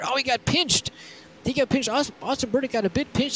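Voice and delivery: excited. Real, not acted. Sudden incident during race.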